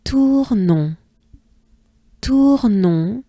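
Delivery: Tournon slowly, drawn-out vowels with a nasal final -on; stretched French Tournon for repeating; French model pronunciation. Shown as a demonstration. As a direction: say Tournon as French "toor-nohn", final n unsounded. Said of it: "let's take a good breath together".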